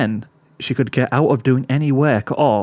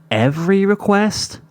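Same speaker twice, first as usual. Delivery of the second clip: put-on young filly voice; 8 semitones higher than usual. Sweetie Belle voiced in story.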